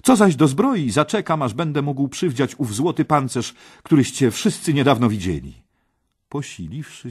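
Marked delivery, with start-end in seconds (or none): 0.0-5.6 put-on high, loud, brash young hero; eager interruption. Parsival interrupts the king, loud and brash. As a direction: cut in with bold confidence. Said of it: "przerwał mu Parsival".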